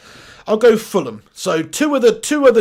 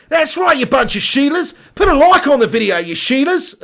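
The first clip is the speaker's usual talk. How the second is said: higher, louder hammed-up Australian accent; mock Australian accent; 5 semitones higher, 3 dB louder than usual. Streamer urging viewers to like the video, mock Australian accent. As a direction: call out like a jokey Aussie.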